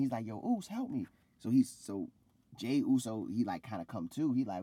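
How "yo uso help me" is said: retold cry for help. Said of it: "Jimmy is screaming".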